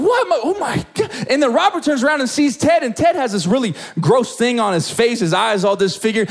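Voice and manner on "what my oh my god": high-pitched disbelieving gasp of words; astonished. Acted. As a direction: stammer it out in amazement. Acts out Robert discovering his healing.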